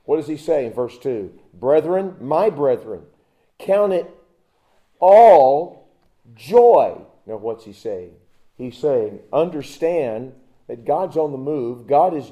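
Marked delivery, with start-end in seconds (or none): 1.5-7.0 loud, ringing scripture reading; preaching scripture. Acted. Preacher booming the verse to the congregation, ringing. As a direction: proclaim each word of the verse.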